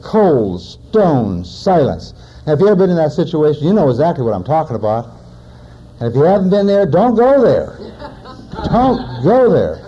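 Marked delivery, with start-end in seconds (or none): 0.0-2.1 very slow, weighted words with gaps; slow, spaced-out delivery for effect; dramatic slow emphasis. Real, not acted. 8.7-9.8 half-speed warning, each word set apart; slow, high, deliberate "don't go there"; slow emphatic repeat. Real, not acted.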